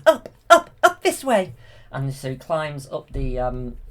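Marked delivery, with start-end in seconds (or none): 0.0-1.5 high, loud fairy call; Flora calling. Retold or imitated. Fairy calls the prince up the cliff, high and loud. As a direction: call him up like an anxious fairy. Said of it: "Flora's, like, saying".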